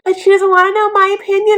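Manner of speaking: high, loud mock complaint; hammed-up self-pitying whine; whiny Betty. Betty complaining about her opinion, high mock whine. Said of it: "And Betty's like".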